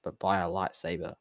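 usual speaking voice

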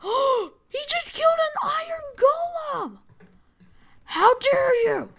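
high-pitched indignant cry; gasp, then cry out in outrage; shocked outrage. Real, not acted. Outraged at a game kill.

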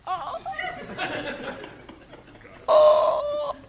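high, airless gasp; winded gasp. Retold or imitated. Heckler gasping after the wind is knocked out. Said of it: "he's trying to say something".

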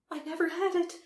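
high, loud Empress protesting; old Empress. A narrator's reading. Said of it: "repeated the Empress".

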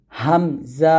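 হামজা said light with a small h at the end; thin hamza demo. Shown as a demonstration. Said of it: "এরকমভাবে পাতলা করে উচ্চারণ করবেন".

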